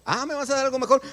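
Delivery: high, eager sarcastic reply; a voiced believer grabbing the offer; mock eager reply. Imagined reply to God. (respuesta burlona y entusiasta, más aguda)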